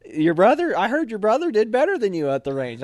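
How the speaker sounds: teasing officer. Retold or imitated. XO teasing about brother.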